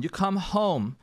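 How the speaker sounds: ordinary talk, nothing marked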